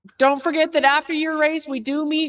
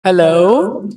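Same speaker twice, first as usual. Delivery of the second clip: loud mic check; 8 semitones lower, 14 dB louder than usual. Real, not acted. Testing a new microphone loudly.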